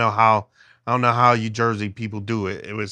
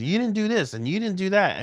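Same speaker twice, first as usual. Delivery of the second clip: mock yell from daughter; 9 semitones higher than usual. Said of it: "she yells at me too".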